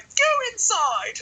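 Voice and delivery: mock stern teacher. Teacher sending him inside, slow high mock order. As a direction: stretch it out like a shrill teacher's command. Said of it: "And then she's like".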